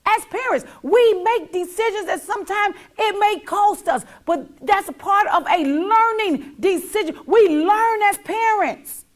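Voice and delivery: loud, high, sermon-like preaching; full-force preaching to viewers. Real, not acted. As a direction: preach it to the whole room.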